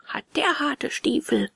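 high yelp easing into complaint; acted schoolboy in pain; pained cry. A narrator's reading. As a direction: yelp at a kicked shin without shouting. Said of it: "schrie der Getroffene".

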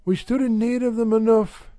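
a hint of a brisk housewife; Mrs Radford's voice. A narrator's reading.

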